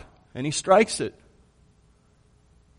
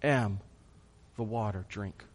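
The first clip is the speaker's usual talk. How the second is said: solemn declaration; 4 semitones lower, 7 dB quieter than usual. Retold or imitated. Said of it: "Jesus stands up and says".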